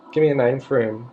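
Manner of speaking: ordinary talk, nothing marked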